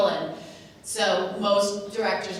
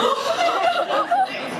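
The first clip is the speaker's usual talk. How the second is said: real laugh, much higher; high burst of laughter; 5 dB louder than usual. Real, not acted. Recalling director making her cry.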